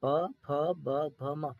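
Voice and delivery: "प, फ, ब" drawn out very slowly, lips pressing on each; slow, careful demo of each lip sound for the class. Shown as a demonstration. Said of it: "जब भी आप ये पांच व-, अक्षर बोलेंगे".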